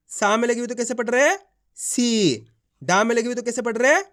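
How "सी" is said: सी with a long, drawn-out ee. Shown as a demonstration. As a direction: stretch the vowel of सी for learners. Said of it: "इस तरीके से इसका".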